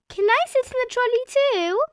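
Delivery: put-on high pleading little-girl voice; Peppa asking. A narrator's reading. Peppa begging Daddy to ride in the trolley, high. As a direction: wheedle like a small child. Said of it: "said Peppa".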